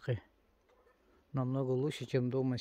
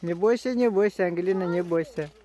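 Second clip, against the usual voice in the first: loud, high reassuring call; encouraging shout; 6 semitones higher, 9 dB louder than usual. Real, not acted. Encourages scared daughter loudly. (громко подбадривает: не бойся)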